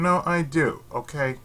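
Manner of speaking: ordinary delivery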